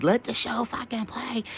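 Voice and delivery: high, slow shout of exasperation; yelling at the TV to just let it play; high exasperated yell. Pretend. Viewer yelling at the TV show, exasperated and high.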